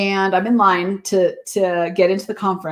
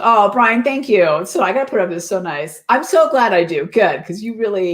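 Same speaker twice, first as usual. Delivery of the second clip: ordinary delivery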